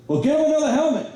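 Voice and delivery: very high put-on coach voice; coach's order. Coach Tardivy telling the stunned sideline to fetch a helmet, high and exasperated. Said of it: "Tardivy turns around and goes".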